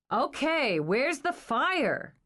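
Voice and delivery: demanding. A narrator's reading. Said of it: "Dana demanded".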